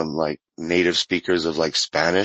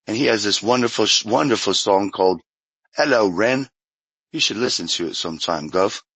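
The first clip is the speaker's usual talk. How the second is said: made-up Cockney. Shown as a demonstration. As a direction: take your time in an invented Cockney accent. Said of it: "cockney one, you know".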